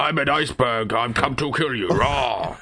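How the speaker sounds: lightly voiced iceberg monster; a hint of a menacing iceberg; talking iceberg. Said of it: "that is my impression of an iceberg".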